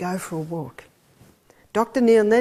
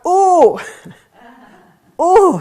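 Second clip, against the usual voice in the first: sore groan; 7 semitones higher, 9 dB louder than usual. Retold or imitated. Sore after yesterday's walk.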